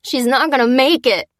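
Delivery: loud, high despairing cry; wail. A narrator's reading. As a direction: wail as if all hope is gone. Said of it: "wailed Jack".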